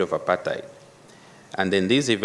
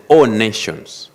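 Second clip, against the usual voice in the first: "all nations" louder and slower; stress on ALL NATIONS; 6 dB louder, slower than usual. Real, not acted. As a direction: lean firmly on "all nations". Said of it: "emphasis on all nations".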